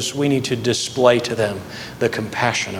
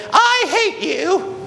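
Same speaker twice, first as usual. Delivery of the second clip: put-on very high, drawn-out child's outburst; little kid voice; much slower than usual. Mad youngest boy to his brother, in a little kid voice. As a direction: mimic a furious small boy, pitched way up. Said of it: "in my little kid voice".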